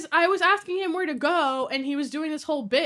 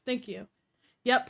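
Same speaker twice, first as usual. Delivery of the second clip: usual speaking voice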